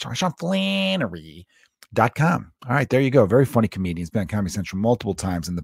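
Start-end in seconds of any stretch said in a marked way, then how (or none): 0.0-2.5 high, drawn-out mimicry of a man saying his own name; Sean Flannery's name delivery. Podcaster plugging a guest's book, mimicking his drawn-out delivery. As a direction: stretch the name out slowly, pitched up. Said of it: "He always says it like that".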